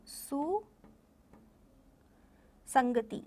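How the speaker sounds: सुसंगति very slow, split after सु; soft सु, long pause, then संगति; slow dictation. Shown as a demonstration. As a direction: dictate सुसंगति softly, part by part. Teacher dictates word for writing.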